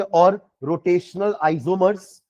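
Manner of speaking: slow, deliberate dictation; dictating. Real, not acted. Teacher gives another name to write down, dictated slowly. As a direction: repeat each term slowly for note-taking. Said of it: "और इसका एक और नाम लिखेंगे".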